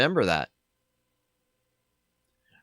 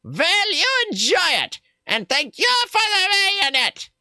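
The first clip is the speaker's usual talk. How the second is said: much higher, put-on trader voice; high character voice; 3 dB louder than usual. Streamer voicing the trader in a high put-on voice. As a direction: squeak out the trader's lines.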